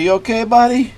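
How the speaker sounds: high caring question to the dog; concerned. Real, not acted. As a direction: check on him gently. Checking on scared dog.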